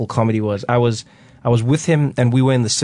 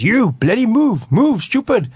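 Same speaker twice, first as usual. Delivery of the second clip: policeman barking; 11 semitones higher, slower than usual. Retold or imitated. Policeman on a horse yelling at grandfather, high mock shout. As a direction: bark the order slowly and high. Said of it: "and he goes".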